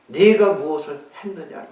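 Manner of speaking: loud, high accusation; accusing question. Shown as a demonstration. God accusing Adam in the wrong reading, loud. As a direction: demand it like a judge. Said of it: "이게 아니었잖아요".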